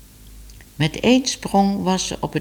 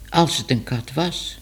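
plain reading voice

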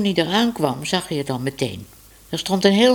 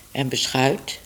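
ordinary delivery, nothing marked

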